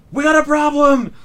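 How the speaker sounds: high loud alarm shout; guard shouting alarm. Acted. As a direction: yell the warning up high. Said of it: "he says".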